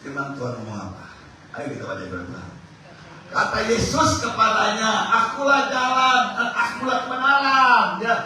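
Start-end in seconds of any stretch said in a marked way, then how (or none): 3.3-8.1 high, loud, fervent preaching with laughs; full-force preaching. Acted. Preacher proclaims Colossians to the congregation fervently. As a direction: proclaim it to the whole church.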